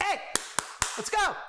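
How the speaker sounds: high-pitched attention-getting call. Acted. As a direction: call out briskly to rally a room. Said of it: "raise my voice to call attention".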